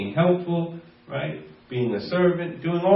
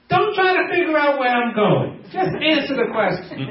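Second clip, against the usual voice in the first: high, firm courtroom judge voice; Judge Judy; 9 semitones higher than usual. Retold or imitated. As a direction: bark it like a TV judge, pitched up. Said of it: "And Judge Judy say".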